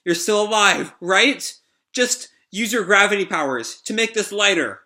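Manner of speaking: worried pleading. Acted. Rebecca straining at the crate over Shiki, worried high plea. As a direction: urge a trapped friend to answer.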